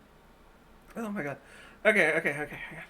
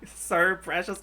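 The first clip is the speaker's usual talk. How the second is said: ordinary talk, nothing marked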